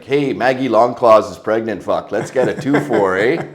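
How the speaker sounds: mock Canadian hoser slang delivery. Kid a week into Canada, talking hoser Canadian. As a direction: put on a rowdy Canadian hoser voice. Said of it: "right into the hoser lifestyle".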